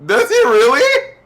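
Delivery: high, loud, drawn-out question; delighted disbelief. Real, not acted. Listener charmed that Jacob drops his R's, high delighted surprise. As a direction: squeal the question, slow and rising. Delighted reaction to cute anecdote.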